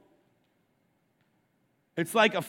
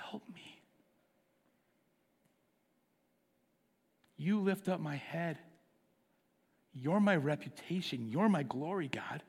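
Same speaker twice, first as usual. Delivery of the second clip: hushed prayer. Acted. David crying to the Lord, hushed broken whisper. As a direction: pray it like a broken man alone. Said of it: "He's like".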